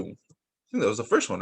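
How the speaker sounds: usual speaking voice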